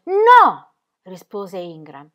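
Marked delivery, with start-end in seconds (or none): 0.0-0.7 high, loud refusal; shouted no. Acted. Ingram refusing Ingeborg's question, shouting. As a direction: bark the refusal at her.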